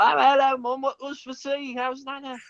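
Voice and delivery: high British chatter, as if greeting Mum; British phone-to-Mum voice. Retold or imitated. Acting out his phone call to his mom, high British accent. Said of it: "I don't sound like me at all".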